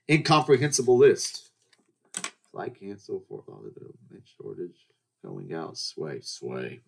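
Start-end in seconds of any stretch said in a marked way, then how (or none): 2.5-6.8 very soft, low mumble; muttering to self. Real, not acted. Host scanning their list of topics, muttering. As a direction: skim notes under your breath. Reads own notes to self.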